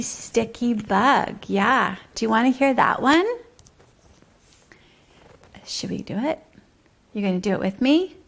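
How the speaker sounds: usual speaking voice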